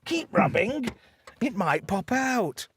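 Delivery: high coaxing eccentric inventor; a daft old boffin egging the boy on, pitched high; mad professor voice. Retold or imitated. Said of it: "mad professor type".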